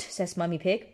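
plain reading voice

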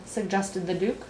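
plain reading voice

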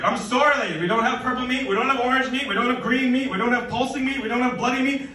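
high, loud exasperated rant; fed-up yell. Retold or imitated. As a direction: rattle off the list like you're about to snap. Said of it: "I wanted to yell at this lady".

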